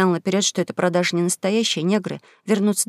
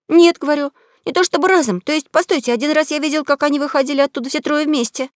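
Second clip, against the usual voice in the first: put-on higher, eager boy protesting; pleading young boy, pitched up; boy's voice; 6 semitones higher, 3 dB louder than usual. Huck tells the duke.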